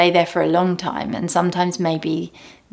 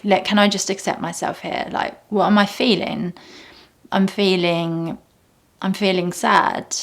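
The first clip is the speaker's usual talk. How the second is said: normal talking voice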